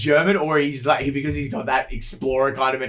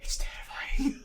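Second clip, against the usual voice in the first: breathy mock terror whisper; 11 dB quieter than usual. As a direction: hush it like it's terrifying.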